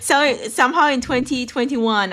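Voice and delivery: high, loud laughing talk; real laughter. Real, not acted. Reader finds her old memorizing note, laughing as she explains. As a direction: burst out laughing and talk through it.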